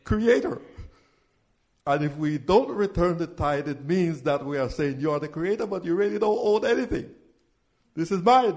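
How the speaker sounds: loud, driving call-and-response preaching; full-force preaching. Acted. Pastor calling the tithe verses to his congregation, loud preaching.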